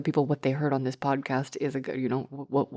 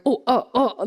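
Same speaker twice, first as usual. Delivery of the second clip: higher-pitched stammer, imitating someone caught out; mock flustered; 7 semitones higher, 4 dB louder than usual. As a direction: act thrown by an awkward request. Imitates flustered people asked to record.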